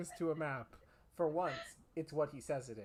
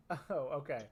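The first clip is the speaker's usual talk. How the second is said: ordinary talk, nothing marked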